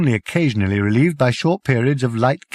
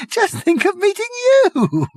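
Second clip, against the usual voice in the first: very high, gasping through laughter; 4 dB louder than usual. A narrator's reading. Prack to Arthur, gasping with laughter. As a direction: gasp it out mid-laugh. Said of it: "he gasped".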